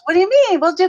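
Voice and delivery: high, loud incredulous outburst; mock-indignant what do you mean; retold disbelief. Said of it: "And she goes".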